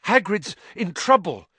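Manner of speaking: breathless and panicked; gasping out the words; out of breath. A narrator's reading. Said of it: "breathing very fast".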